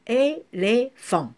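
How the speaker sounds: slow, separated "éléphant"; syllable by syllable. Shown as a demonstration. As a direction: split ÉLÉPHANT into three spaced syllables. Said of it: "couper le mot par syllabe".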